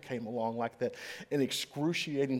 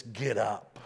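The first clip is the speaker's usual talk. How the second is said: ordinary talk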